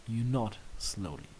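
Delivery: usual speaking voice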